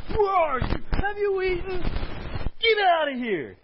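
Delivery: loud, high furious shout; angry God. Acted. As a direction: throw him out in rage. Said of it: "if you hear this angry God".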